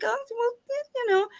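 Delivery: very high, fast mock-fragile mom voice; hammed-up nervous mother; evasive mom. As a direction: squeak it out like a mom hiding bad news.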